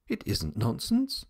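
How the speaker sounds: put-on mildly heated child's retort; indignant denial, understated. A narrator's reading. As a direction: contradict with a touch of heat. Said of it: "replied Jimbo with heat".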